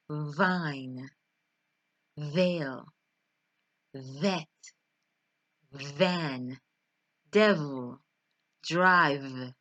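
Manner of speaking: vine, veil, vet, van, devil, drive, each with a buzzing v; vibrating V. Shown as a demonstration. Teacher with fingers on chin, demonstrating a vibrating v. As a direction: say each word apart, letting the v vibrate on the lip. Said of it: "a vibration".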